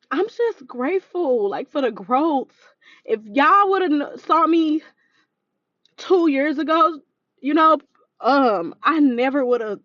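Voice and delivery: choking up while thanking God; holding back tears. Real, not acted. To her listeners about her growth, fighting back real tears. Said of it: "oh, I hate crying".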